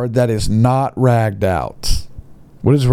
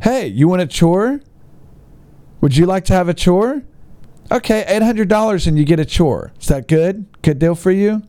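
mock seller; 6 semitones higher than usual. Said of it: "So in other words".

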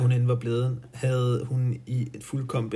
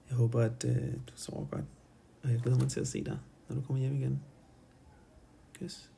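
much softer, tender goodbye; soft goodnight; 7 dB quieter, faster than usual. Real, not acted. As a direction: say goodnight softly to a loved one. Reader's goodnight to loved one. (stille godnat til en elsket)

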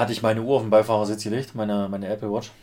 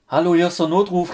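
emergency operator; 8 semitones higher, 7 dB louder than usual. Retold or imitated.

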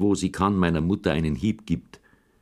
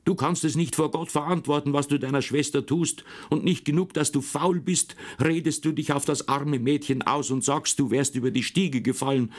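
higher, sharp scolding mother; angry mother; 7 semitones higher than usual. Acted. Mother scolds her son, mildly angry. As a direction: scold the boy in a mild rage. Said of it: "Da wurde meine Mutter so zornig".

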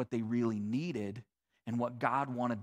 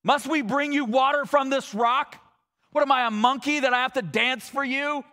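much higher and louder, scolding the people; angry yelling; 10 semitones higher, 8 dB louder than usual. Acted. Moses yelling at the thirsty people, loud angry shout. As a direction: rage at the crowd, fed up. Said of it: "he's yelling at them".

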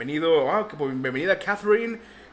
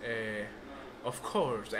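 put-on low, softer accented English; inglés con acento; 5 semitones lower, 10 dB quieter, much slower than usual. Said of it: "habla como español en inglés". (inglés con acento fingido, bajito)

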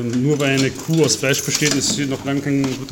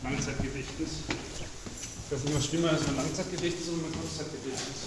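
barely audible; 16 dB quieter than usual. Real, not acted. Very faint recording.

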